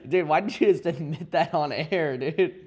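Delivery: high, laughing mock outrage; laughing disbelief. Real, not acted. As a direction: scold a friend while cracking up. Laughing reaction to friend's confession.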